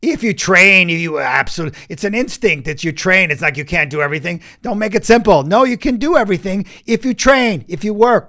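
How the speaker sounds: loud, emphatic and driving; fired up, insisting you can do everything; passionate insistence. Real, not acted.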